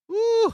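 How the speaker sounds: high, loud laughing whoop. Real, not acted. Host catching breath after a laughing fit, whooping. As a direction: let out a loud high "woo".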